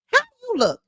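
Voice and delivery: high, mocking squeal of disbelief; mock him with a squeak. Real, not acted. Commentator mocking the man's looks with a high squeal.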